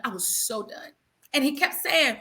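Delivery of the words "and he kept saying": ordinary talk, nothing marked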